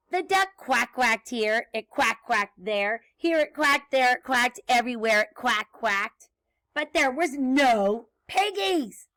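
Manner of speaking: bouncy, rhythmic refrain narration; story read to children. A narrator's reading. Reader recites animal noises for children, bouncy storytelling. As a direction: read it with a nursery-rhyme bounce. Reads picture book, singsong refrain.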